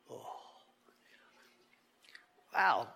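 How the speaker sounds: ordinary delivery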